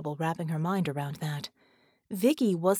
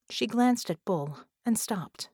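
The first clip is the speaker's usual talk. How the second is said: ordinary delivery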